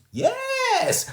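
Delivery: put-on very high, happy little-kid yes; beaming toddler. A narrator's reading. Fudge beaming at his mother, high happy toddler yes. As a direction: beam it out like a proud toddler. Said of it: "Fudge beamed".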